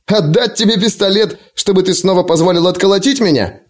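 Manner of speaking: high-pitched scornful question; mocking. A narrator's reading. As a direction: throw the demand back with scorn. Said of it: "издевательски расхохотался".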